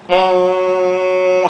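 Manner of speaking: long, steady hum on one note; smooth hum. Shown as a demonstration. Showing that nobody's life is a smooth line, smooth steady hum. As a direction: hold an even, unbroken "mm". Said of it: "smooth line like that".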